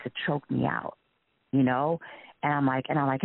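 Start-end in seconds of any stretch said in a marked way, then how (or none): none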